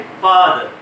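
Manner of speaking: फादर with a long open आ; long aa in father. Shown as a demonstration. Teacher to class, modelling फादर with long आ. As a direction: stretch the आ in फादर. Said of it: "बल्कि क्या कहते हैं".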